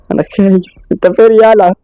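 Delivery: high, frightened call into the dark; scared calling out. Acted. Hossam, scared, calls for Abdo in the dark: high frightened shout. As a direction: voice climbing in fear, calling for Abdo.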